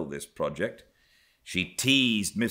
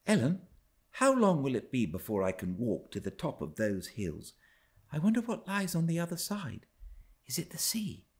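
put-on much higher child voice, quickening with curiosity; a dreamy child gazing at far hills; young Cathy wondering; 10 semitones higher than usual. A narrator's reading. Cathy asks Ellen from the nursery window, high wondering voice. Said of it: "she would observe".

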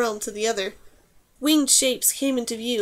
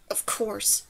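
low, softer grumble; growled; 3 semitones lower, 7 dB quieter than usual. A narrator's reading. Girl grumbling at the wind on the bridge, low growl. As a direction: growl it under your breath. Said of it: "she growled".

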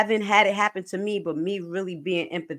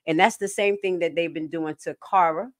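ordinary talk

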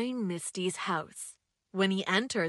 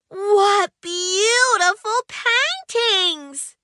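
much higher, slow, drawn-out wonder; amazed; 9 semitones higher, 7 dB louder, much slower than usual. Acted. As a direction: gasp it out in awe. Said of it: "Mimi said in amazement".

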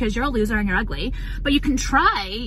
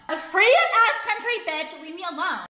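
much higher, slow, sneering "free-ass country"; sarcastic mock; 6 semitones higher, slower than usual. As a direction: stretch it out mockingly.

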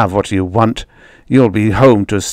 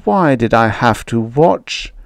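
ordinary delivery

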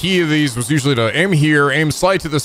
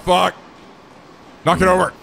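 usual speaking voice

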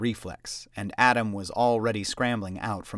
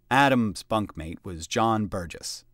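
usual speaking voice

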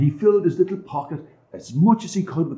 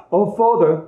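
put-on childlike boy; 9 semitones higher, 4 dB louder than usual. A narrator's reading. Child answers.

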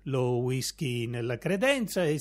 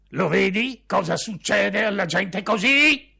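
loud yell, higher; father yelling; 5 semitones higher, 9 dB louder than usual. Acted. Father yelling at his son about such people, loud shout. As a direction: roar it as an I-told-you-so. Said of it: "urlò mio padre".

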